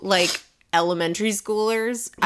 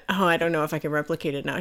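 ordinary delivery

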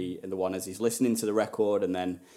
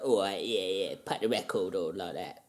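lower, softer mock London accent of Harry Redknapp; a laid-back London mob-boss accent, lower and softer; Harry Redknapp impression; 3 semitones lower, 5 dB quieter than usual. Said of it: "a bit Harry Redknapp".

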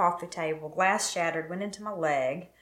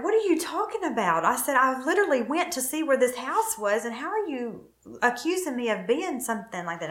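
retold protest, pitched high; indignant protest; 6 semitones higher than usual. Wife answering her husband's accusation, indignant protest. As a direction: push back in a raised, disbelieving pitch.

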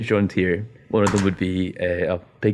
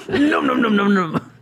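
snapping teeth; 12 semitones higher, 3 dB louder, slower than usual. Pretend. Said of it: "That's the noise it makes".

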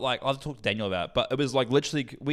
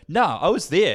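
louder, higher mock indignation; retold angry insistence; 5 semitones higher, 6 dB louder, slower than usual. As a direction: insist heatedly, imitating an annoyed mate. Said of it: "And he's getting angry".

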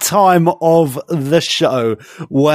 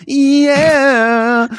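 mock cheer; 9 semitones higher than usual. Host echoing the cheer.

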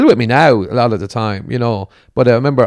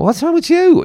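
much higher, lightly voiced question; imagined kinder question; 5 dB louder than usual. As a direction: show what he could have said instead. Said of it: "rather than just going".

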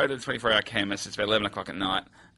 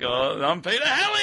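higher-pitched, showy name reveal; playful self-introduction, pitched up; mock self-announcement; 6 semitones higher than usual. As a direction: announce yourself with mock showbiz pride. Mocking announcing who he is.

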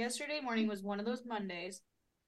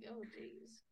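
whispered, barely voiced "Oh, jeez"; groggy mumble; 15 dB quieter than usual. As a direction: breathe it out half asleep, almost no voice. Retelling her hazy morning.

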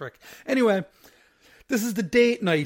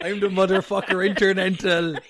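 louder hammed-up champion brag; mock wrestler boast; 5 semitones higher, 6 dB louder than usual. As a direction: boom it like a wrestler. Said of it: "What the fuck was that sentence?".

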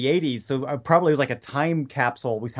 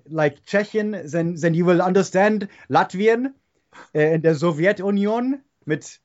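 thick, higher-pitched German-accented English; mock German accent; 3 semitones higher than usual. Shown as a demonstration. Coaching a podcaster on speaking like this, hammed-up German accent. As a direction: put on a heavy German accent, like a teacher demonstrating it. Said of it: "a few things about the German accent".

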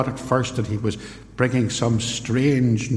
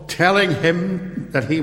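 ordinary delivery

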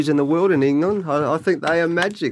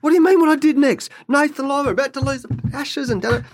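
mock outrage; 12 semitones higher, faster than usual. Retelling to the director, mock outraged protest. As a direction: replay his own baffled outrage. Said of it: "I said".

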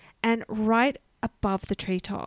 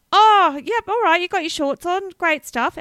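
high, loud cheery mum; chirpy mother waving you off; 8 semitones higher, 9 dB louder than usual. Retold or imitated. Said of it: "your mum would say".

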